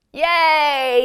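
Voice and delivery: usual speaking voice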